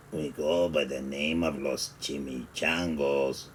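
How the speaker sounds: put-on low, soft growled Spanish accent; growling bandit. A narrator's reading. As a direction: growl it like the gang leader. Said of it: "growled Don Diego".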